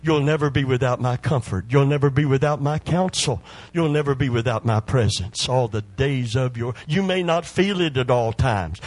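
rousing preacher's assurance, rising in pitch; fervent preaching. Real, not acted. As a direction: build the promise in insistent waves. Preaches at full force.

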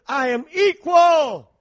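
slow, loud shout; emphatic shout. Real, not acted. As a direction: shout the words slowly.

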